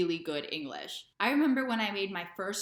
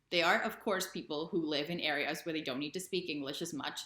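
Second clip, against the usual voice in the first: ordinary delivery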